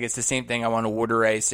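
een milde Boston-imitatie van Mark Wahlberg, luider; Boston accent. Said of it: "I'm doing probably his accent".

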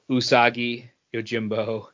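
normal talking voice

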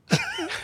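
real, soft burst of laughter; amused laugh. Real, not acted. Listener hearing the suspect claim he's suspended, amused laugh. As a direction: laugh quietly at an absurd excuse.

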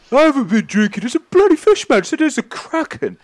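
very high, loud protest; worked-up sailor insisting at full pitch; indignant shouting. Acted. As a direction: yell it back, desperate to be believed. Sailor protests accusation of drinking.

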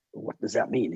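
put-on low American accent, curious; Clint Eastwood voice. Clint Eastwood asking the bodyguard what he means, low accent. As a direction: press him low like Clint. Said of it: "in that accent".

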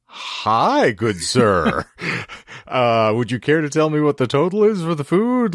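mock-polite drive-thru enquiry, lifted high; exaggerated politeness. Customer asking drive-thru worker the total, exaggeratedly polite.

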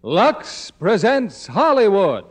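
normal talking voice

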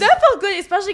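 high, loud laughing reply. Real, not acted. Co-host answering her friend, laughing loudly. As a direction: burst out with delighted laughter.

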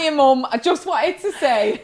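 put-on jokey dubbed child in broad Bolton accent. Dubbing the daughter's message to mum, Bolton accent. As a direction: put on a cheery Bolton accent, half laughing. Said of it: "with, like, a Bolton accent".

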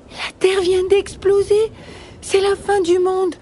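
high-pitched, alarmed bunny voice; panicked little rabbit. Acted. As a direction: squeal the terrible news as a little rabbit. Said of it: "répond Petit Lapin".

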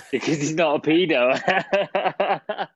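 slow, laughing through the words. Real, not acted. Podcast host mocking the pedo storyline, laughing. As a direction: laugh it out, drawn out. Laughs while arguing the point.

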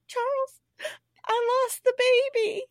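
high, crying imitation of a wife; mock tearful. Ma telling Charles of the miscarriage, mock tearful. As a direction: wail it in hammed-up tears. Voicing sobbing Ma breaking the news.

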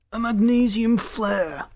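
high, drawn-out outrage; hard, driving words. A narrator's reading. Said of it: "The captain's words drove at Scott".

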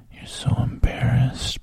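usual speaking voice

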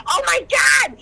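high, loud squeal; imitating a friend's thrilled squeal; excited shriek. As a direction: scream with sudden glee. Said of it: "She goes".

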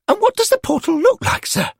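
put-on a young lad asking nervously; schoolboy Derek. A narrator's reading. Derek asking the angels about the portal, high and curious.

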